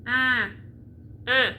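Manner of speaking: un as a nasal eh, repeated; nasal French un. Shown as a demonstration. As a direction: say un through the nose. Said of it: "say eh and then put it in your nose".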